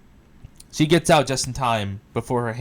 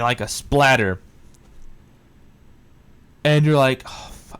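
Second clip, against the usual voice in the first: usual speaking voice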